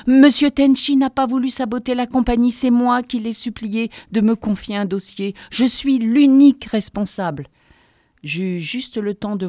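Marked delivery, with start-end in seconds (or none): 0.1-7.5 higher and louder, blurting to shield him; desperate outburst. A narrator's reading. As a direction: cut in loudly to take the blame. Throws herself in to defend boss.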